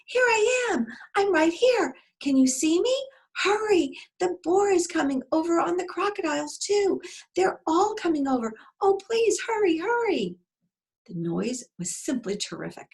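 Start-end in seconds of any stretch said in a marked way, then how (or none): 0.1-10.4 very high, loud pleading cries; frantic yelling. Acted. Dragon begging father to hurry as the boars come, high yelling. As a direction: call out desperately to your rescuer.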